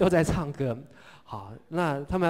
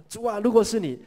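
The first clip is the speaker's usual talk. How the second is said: confident plea; 6 semitones higher, 5 dB louder than usual. Acted. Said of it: "彼得呢，他很有信心".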